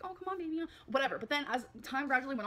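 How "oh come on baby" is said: higher-pitched mock pleading; cute begging. Partner begging to make love, imitated cute pleading. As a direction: imitate a cute little beg. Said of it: "cute little begs".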